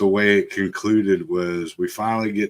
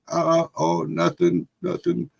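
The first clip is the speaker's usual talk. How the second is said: high-pitched imitation of a small boy covering up; little kid voice; 8 semitones higher than usual. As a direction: play a six-year-old fibbing. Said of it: "the little kid speech".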